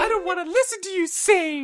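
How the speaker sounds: very high mock whine of a child; echoed tantrum. Dad echoing daughter's car tantrum, very high. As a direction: repeat the kid's whine for fun. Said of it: "she's like".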